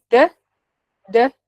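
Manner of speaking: a short, quick "D, D". Shown as a demonstration. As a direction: tap out a fast "D". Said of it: "um D bem rapidinho".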